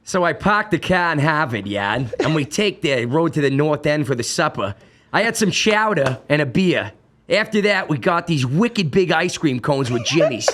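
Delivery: put-on thick Boston accent, dropped R's; broad Boston accent, storytelling. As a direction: read it like a proud Boston local. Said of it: "I do my Boston accent".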